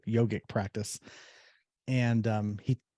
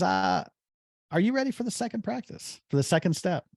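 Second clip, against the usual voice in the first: high gentle question; 9 semitones higher than usual. Retold or imitated. Yogi gently asking mailman.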